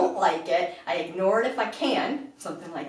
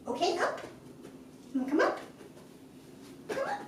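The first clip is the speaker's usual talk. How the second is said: much higher, softer coaxing; high soft come-up call; coaxing the puppy; 10 semitones higher, 9 dB quieter, faster than usual. Real, not acted. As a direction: gently coax the puppy up.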